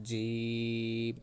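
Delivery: জিম held very long, three alif counts; long jim. Shown as a demonstration. Teacher modelling a three-count jim, drawn out. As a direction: stretch the vowel of জিম long and even. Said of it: "তিন আলিফ টানতে হবে".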